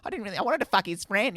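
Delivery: high, quick mock girlfriend voice; brutally honest girlfriend. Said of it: "They'll say something like".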